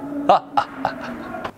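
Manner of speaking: a quiet belly-laugh chuckle; soft jolly chuckle. Acted. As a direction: chuckle softly like a jolly old elf. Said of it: "when he laughed like a bowl full of jelly".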